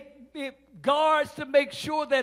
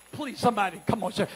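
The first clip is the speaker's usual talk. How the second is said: ordinary delivery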